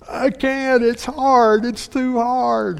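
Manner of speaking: mock child whining it's too hard; whiny child. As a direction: whine like a kid who won't try. Child voiced in a parent story.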